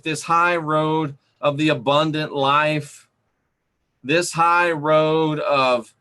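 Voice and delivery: drawn-out preaching, stressing high; preacher slowing down on this high road, stressing high; slow preaching emphasis. Acted. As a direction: stretch each phrase out slowly like a preacher.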